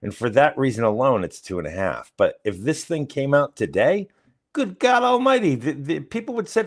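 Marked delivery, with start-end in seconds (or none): 4.5-6.7 exclaiming. Real, not acted. Exclaiming about outrage.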